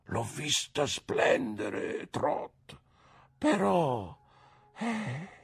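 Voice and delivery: put-on slow, hushed childlike old man; lightly voiced simple man, half wondering; mister Dick. A narrator's reading. Simple old man confides in the boy, hushed and slow.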